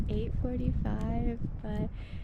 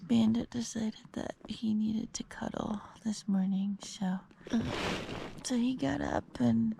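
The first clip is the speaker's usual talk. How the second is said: whispering. Real, not acted. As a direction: whisper so nobody wakes. Early morning, others sleeping.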